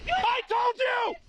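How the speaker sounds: high, desperate yelling command; panicked shout. Real, not acted. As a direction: scream the warning at the driver. Guard screams after shooting.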